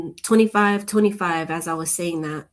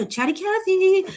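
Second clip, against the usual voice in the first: playful silly voice; 13 semitones higher, 4 dB louder than usual. Pretend. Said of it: "You know me".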